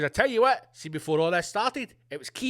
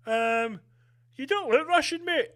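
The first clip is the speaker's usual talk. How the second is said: passport officer voice; 8 semitones higher, 4 dB louder than usual. Retold or imitated.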